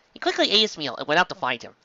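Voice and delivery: plain reading voice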